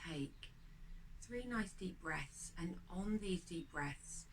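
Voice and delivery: quiet guided breathing meditation voice. Acted. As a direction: lead the breath calmly and gently.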